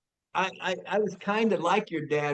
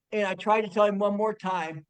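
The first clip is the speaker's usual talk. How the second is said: ordinary talk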